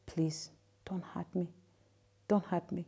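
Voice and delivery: breathy, pleading whisper, barely voiced; frightened whisper. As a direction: beg him softly not to hurt you, scared. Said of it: "I whispered and I said".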